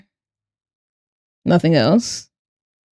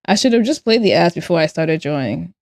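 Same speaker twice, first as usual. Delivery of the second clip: ordinary delivery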